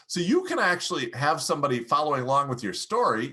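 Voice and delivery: usual speaking voice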